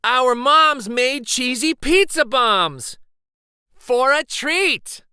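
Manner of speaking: high, loud, slow excited yell. Acted. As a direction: stretch it out like a kid overjoyed about a treat. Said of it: "Luis yelled".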